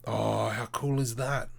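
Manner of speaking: ordinary talk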